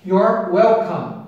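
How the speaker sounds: You're said "yor", slow and clear; slow You're welcome with "yor"; you're like your. Shown as a demonstration. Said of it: "a pronúncia é igual".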